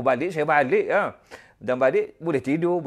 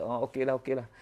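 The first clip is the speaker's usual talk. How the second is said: softer, meek okay; meek giving in; 6 semitones lower, 8 dB quieter, faster than usual. Retold or imitated. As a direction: give in quietly, don't argue. Wife gives in meekly.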